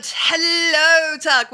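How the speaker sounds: a big called-out hello to a viewer; loud greeting. Real, not acted. Streamer welcoming a new viewer, calling out loudly. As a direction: shout the welcome across the room.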